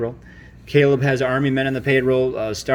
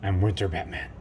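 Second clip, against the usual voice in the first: Batman voice; 6 semitones lower, 9 dB quieter than usual. Acted. Dad voices Batman toy.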